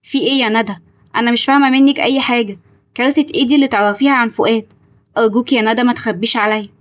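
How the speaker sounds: plain reading voice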